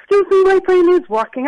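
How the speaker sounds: normal talking voice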